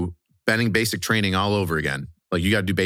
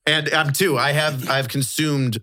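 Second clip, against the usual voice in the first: mock pride; 5 semitones higher than usual. Jokingly mock proud.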